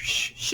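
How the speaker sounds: soft whispered shh like surf; imitated wave shush. Shown as a demonstration. Teacher imitating the ocean for the class, whispered shush. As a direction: breathe out a quiet ocean shush. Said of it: "shushy noise is what I like to call it".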